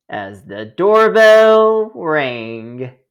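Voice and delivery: slow, drawn-out doorbell build; storyteller slowing for the doorbell; suspenseful slowing. A narrator's reading. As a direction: stretch it out for suspense. Final doorbell, slowed.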